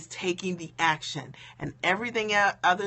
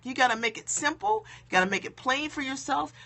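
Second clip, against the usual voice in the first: ordinary talk, nothing marked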